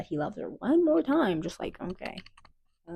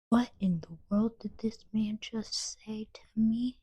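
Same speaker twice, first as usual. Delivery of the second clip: shocked whisper; 3 semitones higher, 6 dB quieter than usual. Acted. Her shocked inner reaction.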